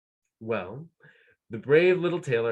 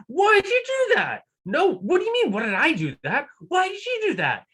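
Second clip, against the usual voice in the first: very high, loud squabbling; two giants bickering in squeals; squeaky giants arguing; 5 dB louder than usual. Acted. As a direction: giants yelling blame at each other, high and loud. Giants yelling at each other.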